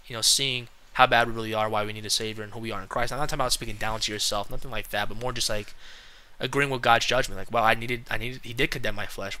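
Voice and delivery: ordinary talk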